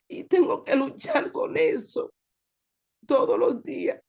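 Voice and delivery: ordinary delivery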